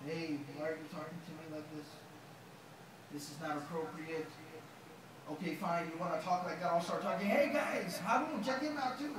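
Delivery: a preacher joking about what Musa did not say; mock wrong reply. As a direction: pretend reply played for laughs. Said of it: "what does he respond then".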